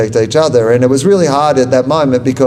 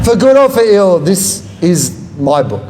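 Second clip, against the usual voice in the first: laughing; 5 semitones higher than usual. Real, not acted. Restarts the line laughing.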